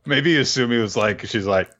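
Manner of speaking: normal talking voice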